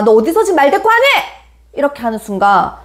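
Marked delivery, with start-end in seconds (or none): 0.0-1.0 high, quick maternal telling-off; scolding mom. Acted. Mom answering a child who talks back, sharp high scolding. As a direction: snap back as an offended mom. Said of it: "그럼 엄마가".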